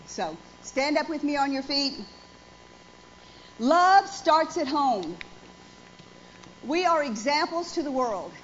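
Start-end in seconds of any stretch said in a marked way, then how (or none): 3.6-5.2 slow, loud, every word weighted; emphatic declaration. Real, not acted. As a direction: drive the point home slowly. Closing sermon point, congregation standing.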